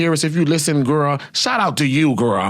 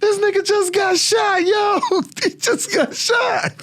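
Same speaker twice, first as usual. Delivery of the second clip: much higher, slow, laughing yell; laughing shout; slower than usual. Retold or imitated. Teen self laughing that a man got shot, high laughing shout. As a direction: howl it through laughter in a high put-on voice. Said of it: "And I'm like".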